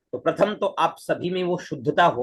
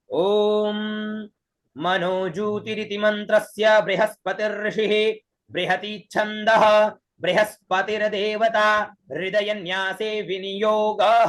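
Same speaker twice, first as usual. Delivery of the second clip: chanted evenly, pitched higher; vedic recitation; 4 semitones higher, slower than usual. Shown as a demonstration. Teacher recites the full viniyoga for students, chanted. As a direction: intone the whole viniyoga like a priest. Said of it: "तो ये मंत्र हुआ आपका".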